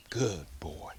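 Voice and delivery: hushed whisper to the dog; whispered praise. Real, not acted. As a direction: breathe the praise, no voice. Praising dog after drop.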